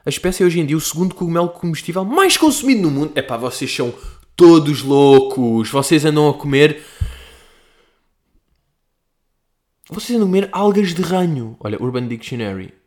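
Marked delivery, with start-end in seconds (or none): 4.3-11.6 exasperated. Real, not acted. Exasperated at viewers.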